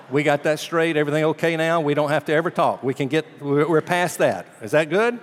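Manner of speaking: preacher imitating a dismissive dinner guest, loud and pushy; mock brush-off. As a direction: mimic a man closing the topic hard. Mocking John's dismissive stance.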